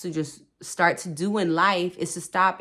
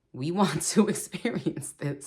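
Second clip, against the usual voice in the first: laughing. Retold or imitated. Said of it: "Sorry, I'm laughing".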